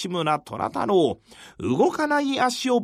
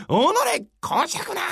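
soldier's battle cry; 9 semitones higher than usual. Acted. Soldiers attack.

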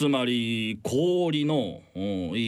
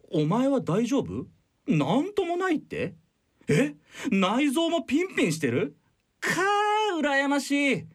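high, hammed-up boss envy; exaggerated envy; 8 semitones higher than usual. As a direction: ham up the jealous boss, pitched high. Boss chatting up new hire. (大げさに羨ましがる上司)

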